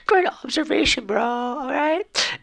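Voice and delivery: very high, slow falsetto; squeaky mock praise. Mocking a commenter's observation, squeaky falsetto praise. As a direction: gush sarcastically in a tiny squeak.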